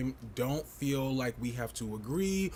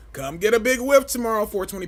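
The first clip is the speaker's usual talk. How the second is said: "Come get a big whiff tomorrow" much higher and louder, punched at the end on "whiff"; louder hype; 9 semitones higher, 10 dB louder than usual. Real, not acted. Pushing the Patreon stream on viewers, a loud high hyped invite.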